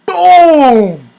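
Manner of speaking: loud, drawn-out falling boom; imitated explosion. Punctuating the story's climax, booming sound effect. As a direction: let it burst and fall away.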